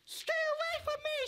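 frightened squeal, very high; scared high cry. Acted. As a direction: shriek it in fear. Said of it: "said Poppy".

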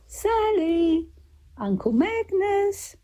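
high, drawn-out sing-song call. A narrator's reading. Someone calling the girl and her uncle home, calling out. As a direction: call their names across the fields. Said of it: "they heard them being called again".

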